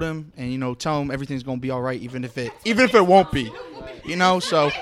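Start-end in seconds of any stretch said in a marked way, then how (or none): 2.6-4.7 laughing. Real, not acted. Joking plea to ladies.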